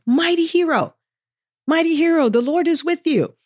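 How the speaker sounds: high, ringing call to a hero; angel's proclamation. Acted. Angel greets a hiding Gideon, high proclamation. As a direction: announce it grandly, pitched well up. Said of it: "The angel of the Lord appeared to him and said".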